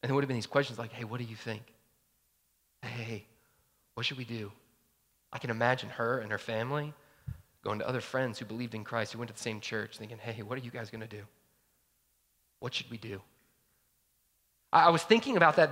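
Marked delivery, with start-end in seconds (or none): 0.9-4.5 low, soft, halting question; hushed conferring. Acted. Neighbours asking each other what to do, hushed. 10.2-13.2 low, quiet question to friends; hushed conferring. Acted. Family going to church friends for advice, hushed.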